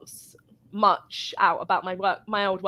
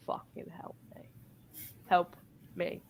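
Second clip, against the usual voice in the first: breathy whisper, softer and lower; whispered plea; 6 semitones lower, 14 dB quieter than usual. Retold or imitated. Whispered plea to her supervisor Dan across the counter.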